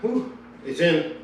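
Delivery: winded and breathless after pushing a car; panting, catching breath between words. Real, not acted. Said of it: "Sorry about my breathing".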